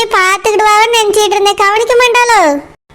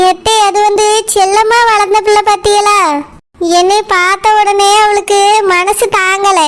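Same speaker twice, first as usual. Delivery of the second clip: ordinary delivery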